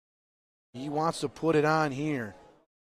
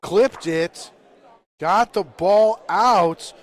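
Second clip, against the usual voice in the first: loud, punched shot call; excited hit call; 4 semitones higher, 9 dB louder, slower than usual. Real, not acted. Commentator as the shot knocks the ball out, excited.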